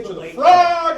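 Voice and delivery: high heroic rallying shout; rally the troops, high and bold; animated battle cry. Retold or imitated. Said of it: "I got animated".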